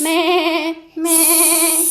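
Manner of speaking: high loud bleating like a goat. Pretend. Mother imitates a goat's bleat.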